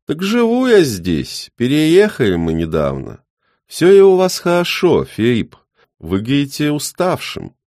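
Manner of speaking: put-on high, loud old man; chirpy elderly neighbour.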